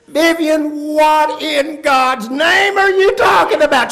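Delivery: high, exasperated shout; exasperated father. Retold or imitated. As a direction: yell at the wife in acted disbelief.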